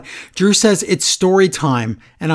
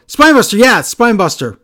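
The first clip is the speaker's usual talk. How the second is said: put-on eager kid repeating every word; childlike echo; 6 semitones higher, 7 dB louder than usual. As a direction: parrot it back like an excited child. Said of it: "the new guy will go, like a child".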